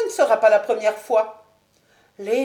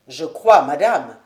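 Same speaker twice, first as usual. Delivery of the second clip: lower, louder exasperated husband; lower, louder annoyed lord; Sir William losing patience; 6 semitones lower, 8 dB louder than usual. Acted. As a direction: finally snap at his wife.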